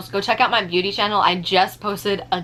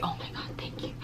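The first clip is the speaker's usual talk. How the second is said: breathless whispered thanks; relieved whisper; 16 dB quieter than usual. Retold or imitated. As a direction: whisper it like you've just been saved. Relieved at finally getting a break.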